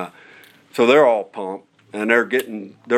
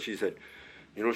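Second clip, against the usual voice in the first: ordinary talk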